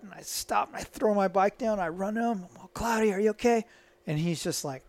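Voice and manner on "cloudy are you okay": high-pitched worried call; mock-urgent check on a fallen friend; acting out mild panic; retold alarm.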